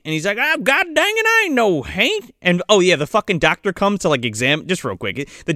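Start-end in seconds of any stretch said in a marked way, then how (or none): 0.4-2.3 put-on high-pitched indignant old grandpa; mock-offended comic outburst; indignant denial. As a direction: protest it in a raised, offended country voice. Grandpa indignantly denies being undead.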